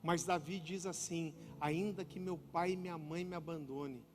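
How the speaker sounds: low, soft, heartfelt sermon voice; tender preaching. Real, not acted. Preacher telling congregation of a father's love, tender and hushed. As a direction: speak gently and reflectively, letting it sink in.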